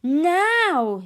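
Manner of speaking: long, high, loud yell; yelled command. A narrator's reading. Hiccup ordering the second bombing run, yelled. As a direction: bellow the signal to attack. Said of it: "yelled Hiccup".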